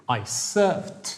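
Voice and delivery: "surfed" said as "surft"; pronounced with a final t. Shown as a demonstration. Teacher models "surfed" with a t sound for the class. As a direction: end "surfed" on a clear t sound. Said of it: "Vodite računa kako izgovarate".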